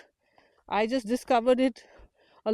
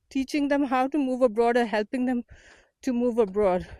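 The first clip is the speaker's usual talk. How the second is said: ordinary talk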